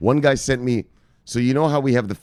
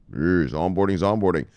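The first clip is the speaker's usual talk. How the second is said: ordinary talk, nothing marked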